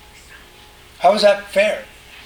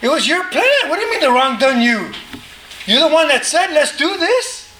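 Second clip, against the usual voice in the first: husband protesting; 6 semitones higher than usual. Acted. Husband retort in acted fight.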